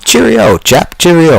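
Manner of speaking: high, hammed-up posh British "Cheerio"; mock British accent. Teasing viewer from England.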